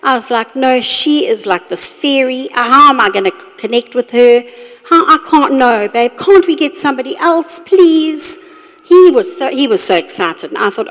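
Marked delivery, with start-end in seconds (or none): 0.6-8.4 wife begging her husband to take someone else, hammed-up; mock pleading. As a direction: beg him like a whiny child.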